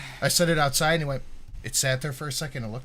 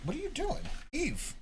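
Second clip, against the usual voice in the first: pet talk voice; 11 semitones higher, 9 dB quieter than usual. Real, not acted. Cooing at the dog.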